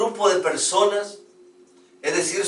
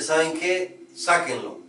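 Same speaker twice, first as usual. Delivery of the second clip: cold king; 7 dB quieter than usual. A narrator's reading. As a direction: brush him off like a bored king. King dismisses the beggar. (rey frío y despectivo, voz más grave)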